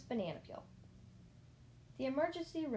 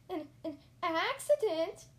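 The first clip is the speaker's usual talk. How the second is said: slow, loud, high stammer of shock; aghast, halting, rising high; 8 semitones higher, 8 dB louder, slower than usual. A narrator's reading. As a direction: stumble into a squealed question. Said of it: "I stammered".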